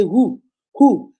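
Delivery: loud, rising outraged who, who; furious auntie. Retold or imitated. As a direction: shout it as the insulted auntie.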